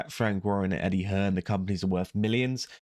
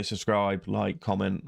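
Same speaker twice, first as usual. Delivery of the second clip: normal talking voice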